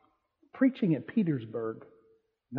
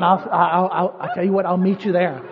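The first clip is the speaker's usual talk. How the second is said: eager laughing voice; 11 dB louder than usual. Retold or imitated. Thief voiced eagerly leaving with Jesus.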